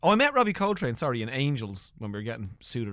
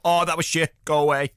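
acted blunt dismissal; 3 semitones higher, 7 dB louder than usual. Retells brusque Irish TV bosses.

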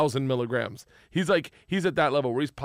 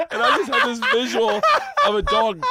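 laughing through the words, much higher and louder; 12 semitones higher, 9 dB louder than usual. Real, not acted. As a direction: crack up while telling it. Laughing at the funny story.

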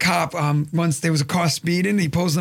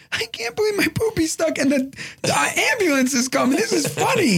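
put-on high, tickled kid voice; laughing little son; 10 semitones higher than usual. Son waiting for the ambulance, high amused kid voice. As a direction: imitate an amused small boy, pitched way up. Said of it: "Now my son's laughing".

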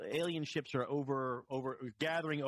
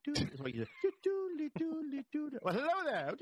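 high, slow, theatrical crooning; hammy stage actor; 12 semitones higher, slower than usual. Pretend. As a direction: ham it up like a luvvie actor. Mocking a theater troupe.

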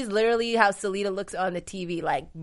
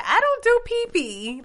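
put-on high, slow, babyish voice; squeaky little-girl whine; imitating Nicole; 14 semitones higher, 3 dB louder, slower than usual. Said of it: "when Nicole said".

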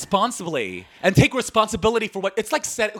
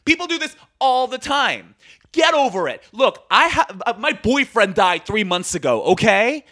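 real anger; 5 dB louder than usual. Real, not acted. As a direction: let the anger build to a loud final curse. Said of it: "I'm so angry".

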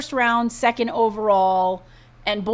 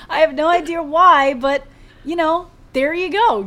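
laughing through the words; 5 semitones higher, 6 dB louder than usual. Real, not acted. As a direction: giggle while you talk. Laughing over Roadhouse news.

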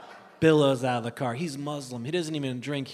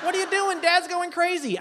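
high-pitched mom on the phone; frantic mom; 13 semitones higher than usual. Acted. Mom phones daughter about dad, high frantic imitation. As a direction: scold in a high mock-mom voice. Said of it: "She's like".

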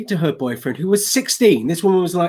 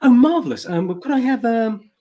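a light posh English Hugh Grant voice, higher at times; Hugh Grant impression; 5 semitones higher than usual. English tourist ordering beers at a Californian bar in a light Hugh Grant voice. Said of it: "I went full Hugh Grant".

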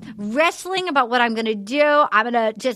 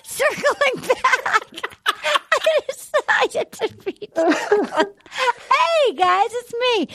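much higher, slow, put-on voice; silly high voice; 9 semitones higher, much slower than usual. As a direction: squeak it out like a cartoon. Goofy high voice.

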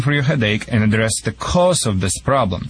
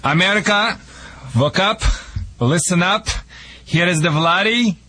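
higher, slow announcer pitch with a heavy put-on Russian accent; hammed-up radio ad; 4 semitones higher, slower than usual. Vladdy plugging his show to America, infomercial announcer voice. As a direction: sell the dog wizard like a late-night infomercial.

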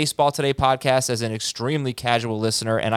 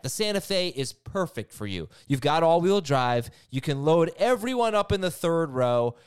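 upbeat sponsor read voice; 3 semitones higher, 5 dB quieter than usual. Acted. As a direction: sell it like a radio ad. Host reads sponsor ad.

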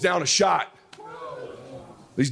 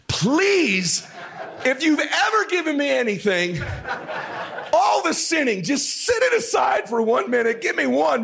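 high, impassioned begging prayer; desperate plea; 9 semitones higher than usual. Retold or imitated. Dad praying over son's shot, desperate high plea. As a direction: beg heaven at a high pitch.

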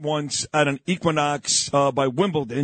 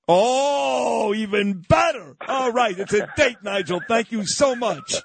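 excited, higher-pitched burst of thanks; delighted; 7 semitones higher than usual. Real, not acted. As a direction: exclaim it in real delight, pitched up. Delighted at dinner invitation.

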